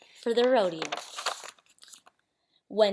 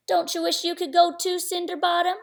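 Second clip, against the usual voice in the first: a higher, mocking sneer at her stepsister; 7 semitones higher than usual. Older stepsister taunting Cindy about the party, sneering. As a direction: taunt her, nose in the air. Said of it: "sneered the older sister".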